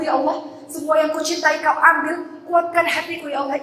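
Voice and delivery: impassioned prayer. Acted. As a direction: cry out to God, begging for strength. Preacher voices an anguished prayer. (doa penuh rintihan, lebih tinggi dan keras)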